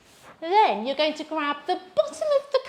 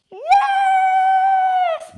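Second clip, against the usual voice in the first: much higher, louder cheer; excited yes; 10 dB louder than usual. Acted. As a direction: cheer like a kid at the park. Arriving at the park.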